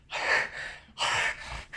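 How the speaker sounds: heavy voiceless exhales; imitated breathless panting. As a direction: mimic runners catching their breath at the finish. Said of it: "take a minute to catch our breath".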